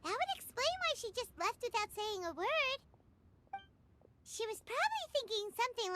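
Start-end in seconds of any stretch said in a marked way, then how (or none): none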